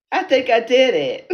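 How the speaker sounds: loud, high, triumphant outburst; delighted. Real, not acted. Celebrating her accent attempt to viewers, delighted. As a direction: crow it with excitement.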